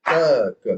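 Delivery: "ta" struck strongly as the phrase comes round; stress on TA. Shown as a demonstration. Teacher signals the start to a layman listener, accented "ta". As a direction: hit "ta" hard, "ka" lighter. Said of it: "something is happening at ta".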